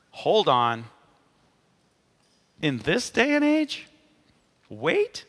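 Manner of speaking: high-pitched, drawn-out disbelief; mock incredulous. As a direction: question it in an exaggerated, incredulous squeak. Voicing an objection to waiting.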